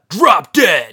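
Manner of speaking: high, loud outburst; a yelled drop dead at the photographer; sudden shout. Retold or imitated. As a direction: bark it out furiously. Dumped guy snaps at photographer.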